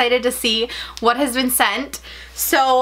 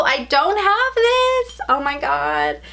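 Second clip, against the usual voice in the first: thrilled; 5 semitones higher, slower than usual. Real, not acted. Thrilled at the new mug.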